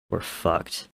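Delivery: breathy, almost voiceless resignation; hushed despair. Acted. Tommy to his brother, hopeless whisper. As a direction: let it fall out under the breath.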